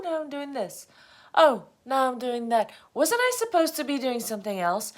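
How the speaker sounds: high, mock-confused chatter; flustered inner voice. Her thoughts swimming around in confusion, high and flustered. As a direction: flit from thought to thought, pitched high.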